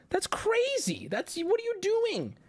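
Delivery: high, squeaky disbelief; incredulous outburst. Acted. Comic ranting about neglected teeth, high-pitched disbelief. Mock outrage at bad teeth.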